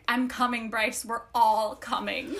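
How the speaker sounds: low, slow earnest quote; Ethan's low voice. Retold or imitated. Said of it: "Ethan is like".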